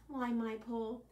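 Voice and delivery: ordinary talk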